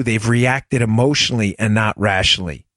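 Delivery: normal talking voice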